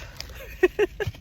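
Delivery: high unvoiced laugh; breathy laugh. Real, not acted. Laughing at the wind.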